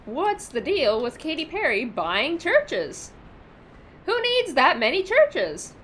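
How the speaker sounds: exaggerated observational stand-up delivery; Jerry Seinfeld. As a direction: pitch leaps up on the baffled question. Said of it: "When is a Seinfeld stand-up stream?".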